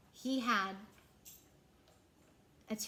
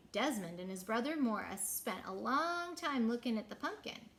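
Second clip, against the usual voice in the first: ordinary delivery, nothing marked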